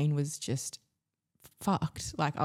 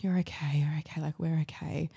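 whispered reassurance. Retold or imitated. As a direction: hush her gently, over and over. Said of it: "that whole primal, the speaking that you just unconsciously do".

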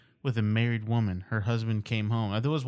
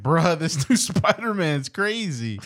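laughing through the words; 4 semitones higher, 6 dB louder, slower than usual. Retold or imitated. Quotes comment while laughing.

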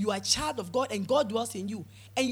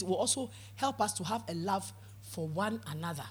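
ordinary talk

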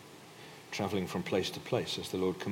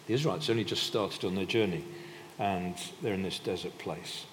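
usual speaking voice

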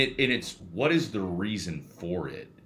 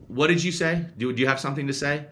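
retold confrontation; 7 semitones higher than usual. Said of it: "and I just say".

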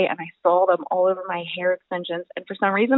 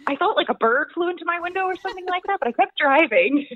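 higher-pitched, giggly telling; laughing while talking; 10 semitones higher than usual. Real, not acted. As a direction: laugh through your own silly blunder. Laughing while telling her own blunder.

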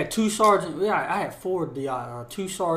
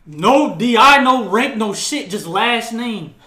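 loud, higher retold tirade; mock drill-instructor rebuke; 5 semitones higher, 9 dB louder, slower than usual. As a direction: rebuke the recruit like an outraged instructor. Retelling a drill instructor's angry outburst.

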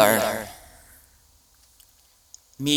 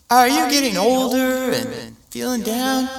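high, loud sales pitch; cheesy ad announcer; 10 semitones higher, 3 dB louder than usual. Retold or imitated. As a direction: hook them like a TV ad. Mock mental health ad.

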